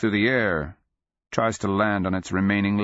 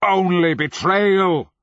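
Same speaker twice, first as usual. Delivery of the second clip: slow, high, loud roar of rage; thunderous drawn-out fury; furious roar; 12 semitones higher, 7 dB louder, slower than usual. A narrator's reading. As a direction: roar it out, stretching the words. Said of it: "he roared".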